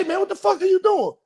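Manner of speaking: high-pitched comic outrage; alarmed scolding. Retold or imitated. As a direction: act out scolding a dozing passenger. Driver scolds passenger falling asleep.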